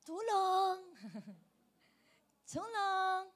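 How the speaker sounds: drawn-out joking plea, laugh between; half-hearted help cry. Pretend. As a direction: pretend to be drowning without much force. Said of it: "Pag humihingi ka ng tulong".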